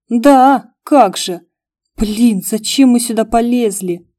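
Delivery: loud indignant outburst; outraged. Acted. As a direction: burst out in alarm. Brother outraged at the body. (возмущённый выкрик)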